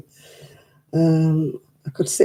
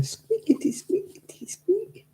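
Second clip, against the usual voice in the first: tiny, soft squeaky mouse noises; little mouse squeak; 13 semitones higher, 7 dB quieter, slower than usual. Retold or imitated. Knitter showing how her wool goes, little mouse squeak. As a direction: imitate squeaking wool, ending high and tiny. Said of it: "It's like a little mouse".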